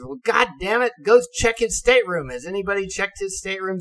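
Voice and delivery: mock officer losing his patience; exasperated officer. Officer telling crew to search the stateroom, exasperated.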